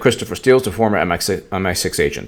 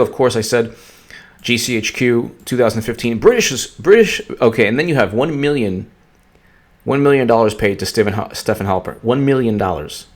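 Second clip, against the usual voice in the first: ordinary talk, nothing marked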